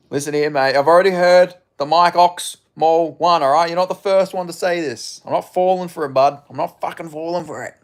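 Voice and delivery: loud, hammed-up bloke warning off a chatter; loud mock threat at a joking viewer; mock tough guy.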